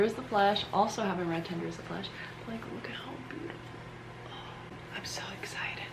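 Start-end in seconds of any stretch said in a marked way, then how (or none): 2.5-5.8 hushed, fast, excited whisper. Real, not acted. Whispering over a new book so sleeping roommates don't wake. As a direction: whisper so the roommates stay asleep. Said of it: "I'm whispering 'cause my roommate".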